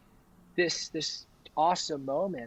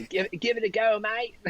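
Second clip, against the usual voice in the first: higher-pitched, hammed-up Australian accent; terrible Aussie accent; 7 semitones higher than usual. Friend mimicking Aussies urging a try, hammed-up Australian accent. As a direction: do a bad Australian mate. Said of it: "this terrible Aussie accent comes out".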